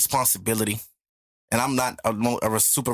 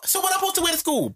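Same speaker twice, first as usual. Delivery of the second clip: panicked protest; faster than usual. Retold or imitated. Retold protest to mom.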